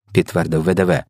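usual speaking voice

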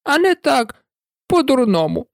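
a tiny creature squeezed and grumbling; Dormouse voice. A narrator's reading. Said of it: "сказав вовчок".

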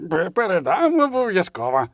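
stumbling dim servant voice; tongue-tied lackey. A narrator's reading. Lawyer posing as a lackey accepts the letter, tongue-tied. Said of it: "чудово вжившись у роль недорікуватого лакея".

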